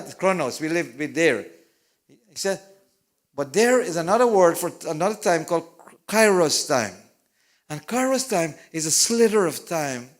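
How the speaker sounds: urgent pulpit call to act today; full-force preaching. Acted. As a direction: press the congregation to decide now.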